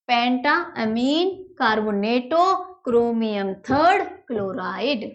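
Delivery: syllable by syllable, very slow; slow dictation. Shown as a demonstration. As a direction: read the compound name out slowly for students. Reads out the finished compound name. (धीरे-धीरे बोलकर लिखवाना)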